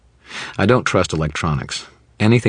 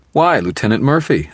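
much higher, sickly-sweet mock gushing; sugary gush; 8 semitones higher, 5 dB louder than usual. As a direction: lay the sarcasm on thick. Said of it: "I gushed, overflowing with saccharin".

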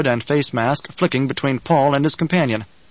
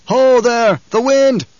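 high, loud lookout's shout; calling out; 10 semitones higher, 6 dB louder, much slower than usual. A narrator's reading. Lookout warns the men below of coming wind, high calling shout. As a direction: call it down from a ridgetop, pitched high. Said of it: "A voice called out from the ridgetop".

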